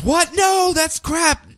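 high, slow shocked cry; mock Lana freaking out; freaked-out disbelief. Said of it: "she freaks out and goes".

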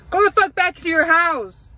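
high, loud exasperated rant; angry outburst. Real, not acted. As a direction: shout it at the man hovering at the door. Venting at man waiting at door.

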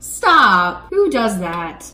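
usual speaking voice

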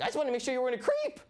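put-on high, quick girlish voice; lightly voiced girl. Date explaining why she researched him, quick high voice. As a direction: rattle it off in a bright high voice. Date's voice, much higher.

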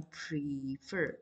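"prefer" slow, stressed on the second syllable; teacher slowly saying "prefer" stressed at the end; pronunciation demo. Shown as a demonstration. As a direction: model "prefer" with the stress on FER. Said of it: "它念prefer，短母音".

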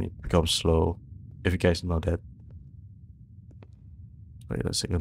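ordinary talk

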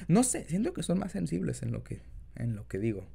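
usual speaking voice

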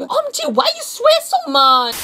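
very high mock squeal; shocked commenter. Commenter asking why he swears, mock-shocked squeal. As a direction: squeak it in hammed-up dismay. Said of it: "you want me to show you?".

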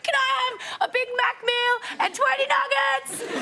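high-pitched, drawn-out excited holler; hollered food order. Retold or imitated. Retold drive-through order from the limo.

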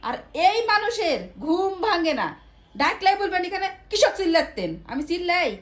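high-pitched, exasperated scolding; yelling at husband. Real, not acted. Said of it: "তুমি এত চিল্লাচ্ছ কেন". (বিরক্ত হয়ে চেঁচিয়ে বকা)

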